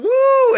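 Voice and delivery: high, loud whooping cheer; crowd "woo". Retold or imitated. One guy starting the wave, loud high whoop. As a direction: let out a long high woo. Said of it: "standing up and just going".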